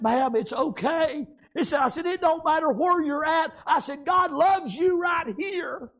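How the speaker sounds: high, loud, slow comfort; reassuring revival cry; preaching reassurance. Retold or imitated. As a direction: lift her up with the words. Preacher comforting the weeping lady.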